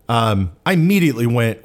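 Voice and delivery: ordinary talk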